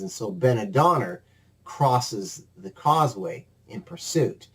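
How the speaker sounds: ordinary talk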